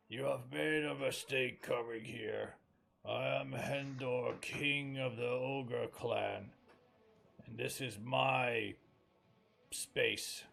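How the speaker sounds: loud, slow, threatening ogre king; menacing ogre king. Acted. Ogre king warning intruding adventurers, slow and menacing. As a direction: boom it out like an ogre king claiming his lair.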